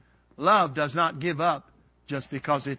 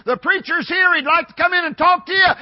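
wife calling out, high and loud; hollering; 10 semitones higher, 7 dB louder than usual. Retold or imitated. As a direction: holler it through the house. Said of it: "my wife hollered to me".

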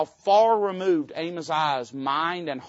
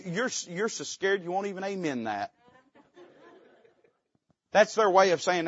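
ordinary talk, nothing marked

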